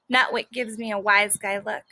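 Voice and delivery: ordinary delivery, nothing marked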